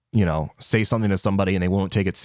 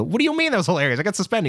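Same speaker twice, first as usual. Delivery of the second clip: high-pitched retold indignation; incredulous protest; 11 semitones higher, much faster than usual. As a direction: protest in disbelief, pitch jumping up. Retold protest to union guy.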